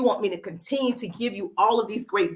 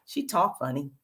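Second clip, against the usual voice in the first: lightly mimicked viewer, lower and softer; imitating viewers; 6 semitones lower, 4 dB quieter than usual. Host retells what viewers say about her, lightly imitating. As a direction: drop low and quiet for their remark. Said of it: "And they're like".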